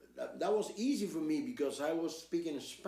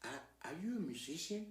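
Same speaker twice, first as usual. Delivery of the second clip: much softer, leaning in close; hushed aside; 2 semitones higher, 10 dB quieter than usual. Retold or imitated. Nervous soloist quietly asking the translator, hushed. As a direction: drop it to a private murmur. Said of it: "I came close to him and I said".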